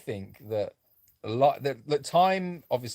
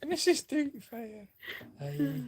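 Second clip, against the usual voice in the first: much higher, slow Scottish granny voice; Mrs Doubtfire; 13 semitones higher, 4 dB quieter, slower than usual. Retold or imitated. Friend mentions his softer Scottish voice, he answers as a high, slow Mrs Doubtfire. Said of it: "Missus Doubtfire?".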